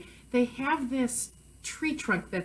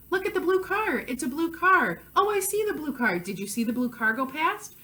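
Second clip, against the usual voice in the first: animated, high-pitched parent pointing things out; monologuing to child; 5 semitones higher than usual. Shown as a demonstration. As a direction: chatter excitedly to a small child, ending on a rising question. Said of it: "student is monologuing".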